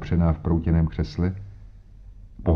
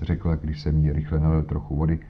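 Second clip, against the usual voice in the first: plain reading voice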